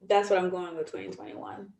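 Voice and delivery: normal talking voice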